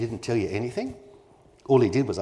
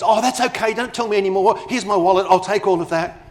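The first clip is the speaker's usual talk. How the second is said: much higher and louder, hammed-up eager buyer; mock eager client; 8 semitones higher, 6 dB louder than usual. Wishful client to the chatty salesperson, mock eager and much higher. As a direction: overact the dream customer who hands over the wallet. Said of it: "the client goes".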